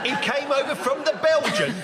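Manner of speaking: high, laughing disbelief; mock his slip in disbelief; incredulous. Real, not acted. Mocking a slip.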